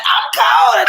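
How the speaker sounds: put-on high loud child's whine; whiny child. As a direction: whine it like a little kid. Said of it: "she like".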